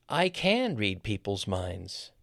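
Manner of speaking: slow line with CAN drawn out in full; stress on CAN. Shown as a demonstration. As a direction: lean hard on CAN as a surprising claim. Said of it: "You're stressing the can".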